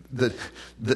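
usual speaking voice

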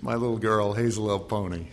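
laughing through the name. Real, not acted. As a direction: chuckle while saying Hazelelponi.

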